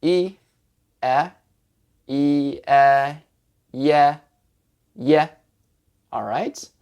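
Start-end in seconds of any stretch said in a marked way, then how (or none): 0.0-1.3 "E" as ee, then "E" as eh, apart; two distinct held vowels; separate vowels. Shown as a demonstration. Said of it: "So it's E and E". 2.1-5.3 ee and eh sped up into one "ye"; blending vowels. Shown as a demonstration. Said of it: "faster until they're one sound".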